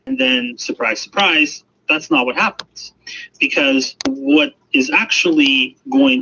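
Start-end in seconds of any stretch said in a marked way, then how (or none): none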